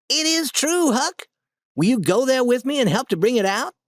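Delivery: put-on high, bright-eyed boyish urging; eager Tom. A narrator's reading. Tom begs Huck to come to the cave, high eager voice.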